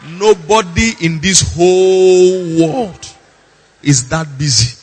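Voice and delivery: "whole world" drawn out, loud and high; emphatic stretch. Real, not acted. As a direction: hammer "nobody", then stretch "whole world" slowly. Emphatic claim after quieter aside.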